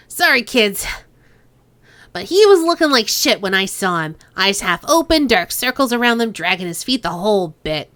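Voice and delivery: a light nasal Hong Kong gangster lilt, teasing; Chang's nasal accent. Acted. Boss Chang sizing up the cop, light nasal accent. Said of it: "to do Kindly Chang's accent".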